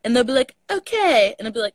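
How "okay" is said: higher, mock-British echo; imitated British lilt, pitched up; mimicked British accent. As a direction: tease by parroting it in a British accent. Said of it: "too U K for them".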